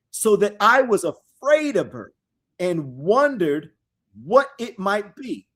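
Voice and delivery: slow, loud, solemn reading of scripture; dramatic scripture reading. Acted. Preacher reading prophecy aloud to his listeners, solemn and loud. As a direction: proclaim the verses slowly to the congregation.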